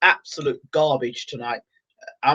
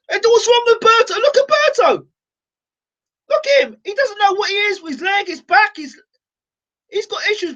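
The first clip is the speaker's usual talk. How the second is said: distressed. Real, not acted. Fan seeing Berto badly hurt, high distressed shout. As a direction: cry out in alarm at the injured fighter. Fighter clearly injured on screen.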